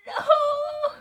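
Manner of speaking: high, loud cry of protest; shocked shout. Real, not acted. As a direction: yell no in disbelief. Reader protesting the text.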